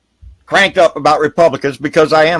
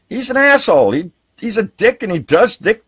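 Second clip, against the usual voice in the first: angry outburst; 8 semitones higher than usual. Real, not acted. Ranting to viewers about Trump, a sudden angry outburst. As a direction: spit the insult out.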